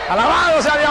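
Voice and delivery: exultant preacher's exclamation; praise shout. Real, not acted. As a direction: sing out praise to the congregation at full pitch.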